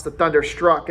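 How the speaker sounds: plain reading voice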